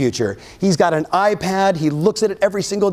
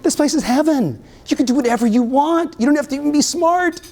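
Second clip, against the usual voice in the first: higher, put-on voice for the trainer from Compton; imitating the trainer; 10 semitones higher than usual. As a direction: lift the voice up to quote the trainer's praise. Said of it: "he's like".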